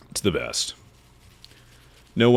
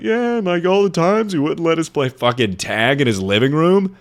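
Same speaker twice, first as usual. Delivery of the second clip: mock grieving voice; 9 semitones higher than usual. Recalling the banned tag games, high mock whine.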